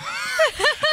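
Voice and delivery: loud high shriek of laughter. Real, not acted. Host laughing hard.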